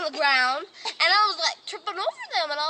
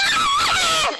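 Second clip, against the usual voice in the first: very high, loud laughing shriek; mock shriek; 8 dB louder than usual. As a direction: let out a silly scream. Imitating a scream.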